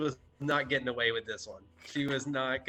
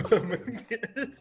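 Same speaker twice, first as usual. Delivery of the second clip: laughing; 11 semitones higher than usual. Real, not acted. Laughing at reveal.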